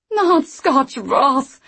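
drawn-out high wail. Acted. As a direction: wail it in dismay. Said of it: "she wailed".

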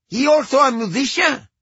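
put-on high Cuban-accented woman. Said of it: "A woman with a thick Cuban accent".

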